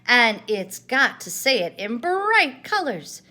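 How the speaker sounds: loud, slow, emphatic boast; bouncy Tigger. A narrator's reading. Tigger insisting the valentine be colorful, boisterous. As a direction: sell bright colors with gusto. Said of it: "Tigger's booming voice".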